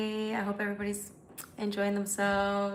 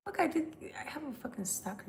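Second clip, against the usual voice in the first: hushed whisper, no voice; 5 semitones higher, 8 dB quieter than usual. Real, not acted. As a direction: whisper it like a secret to the chat. Confiding to chat about stalker.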